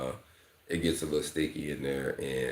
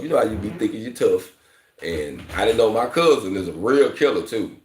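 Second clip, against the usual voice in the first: ordinary talk, nothing marked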